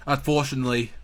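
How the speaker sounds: ordinary talk, nothing marked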